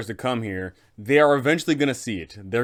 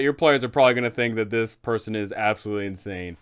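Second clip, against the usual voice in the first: ordinary delivery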